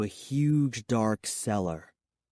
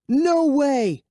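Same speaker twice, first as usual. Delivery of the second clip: high, loud panicked cry; 14 semitones higher, 6 dB louder than usual. Acted. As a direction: blurt it as you dodge. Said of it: "I cried".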